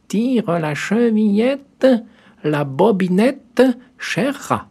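high, slow put-on granny voice; the wolf faking a gentle grandmother; wolf softening his voice. A narrator's reading. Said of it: "en adoucissant un peu sa voix".

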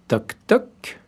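high voiced knock knock. A narrator's reading. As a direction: tap out the knock with the voice. Wolf knocks at the door.

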